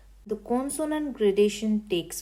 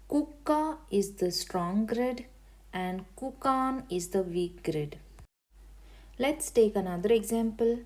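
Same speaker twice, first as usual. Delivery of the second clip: normal talking voice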